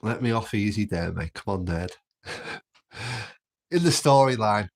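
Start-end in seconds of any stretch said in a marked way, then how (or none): 2.2-4.7 laughing the words out, louder with relief; relieved laugh. Real, not acted. Relieved that chat meant the storyline, laughing. Relieved it was only fiction.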